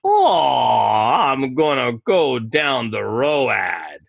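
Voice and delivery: put-on loud, slow, drunken Southern drawl; mock Southern drawl. Imitating a drunk he made fun of, broad Southern drawl. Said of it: "this is how they speak in the South".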